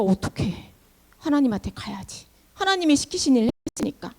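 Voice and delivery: low, soft worrying to oneself; troubled muttering. Acted. Moses, blamed by his people, decides to go to God, troubled and hushed. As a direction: think aloud quietly, at a loss.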